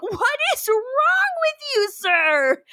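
high, drawn-out mock indignation; comedic outrage. Shown as a demonstration. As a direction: overplay it like a campy sitcom scolding. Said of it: "it needs to be more comedic".

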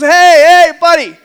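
loud, high call from far off; big drawn-in hey, insistent calling; screaming parrot. Acted. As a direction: holler a buddy over as a pushy parrot. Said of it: "this parrot is screaming in the background".

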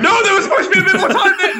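very high, fast squealed protest; comic panic. Retold or imitated. Protesting that the doll came back too soon, panicked squeal. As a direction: squeal it in a laughing panic, rushing the words.

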